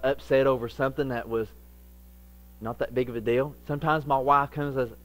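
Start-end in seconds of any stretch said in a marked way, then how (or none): none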